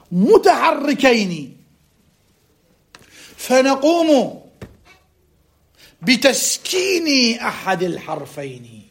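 slow dictation with long stretched syllables; emphatic, drawn-out classical Arabic dictation. Real, not acted. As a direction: dictate word by word, punching key words loudly. Repeats and continues the dictation.

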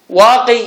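"واقي" closed with a long ee; final yaa sounded. Shown as a demonstration. Teacher dictates the stopping form to students, "واقي" with long final ee. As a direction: pronounce the yaa at the end of "واقي". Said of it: "بالياء".

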